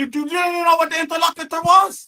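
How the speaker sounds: high-pitched, heated challenge; indignant accusation. Real, not acted. Heated argument, accusing opponent.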